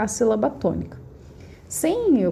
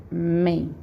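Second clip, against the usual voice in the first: Men held alone, slightly lower; stressed syllable alone; 3 semitones lower than usual. Shown as a demonstration. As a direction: isolate the tonic syllable Men. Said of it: "qual que é a minha sílaba tônica?".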